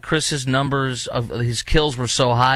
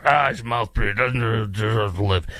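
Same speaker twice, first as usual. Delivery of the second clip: Graham Plattner. Acted. Host mimicking a candidate insulting a veteran, gravelly mock voice. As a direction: grumble it as a dismissive caricature. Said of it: "in your gravelly voice".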